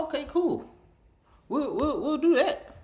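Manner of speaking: high cheerful imitation; high mock agreement. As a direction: chirp it like an overeager customer. Quoting their cheerful reply.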